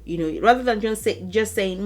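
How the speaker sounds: ordinary delivery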